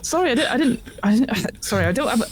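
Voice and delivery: high, stammered acted denial; flustered pinned man protesting; panicked apology. Tackled man apologises to accuser.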